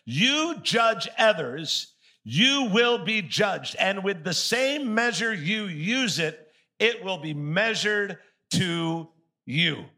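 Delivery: very slow, deliberate preaching of the verse; strong, slow scripture reading. Shown as a demonstration. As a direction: drag each word out with weight. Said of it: "This is extremely strong what Jesus is saying".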